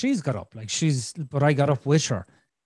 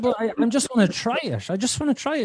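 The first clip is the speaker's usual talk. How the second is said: higher-pitched, mildly insistent retold protest; retold plea; 7 semitones higher, faster than usual. Husband asking wife to let him try, retold pleading. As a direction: act out pleading for a chance.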